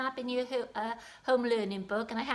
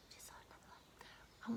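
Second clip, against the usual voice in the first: whisper; 19 dB quieter than usual. Shown as a demonstration. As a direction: whisper it, voiceless and hushed. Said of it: "where we are not quiet".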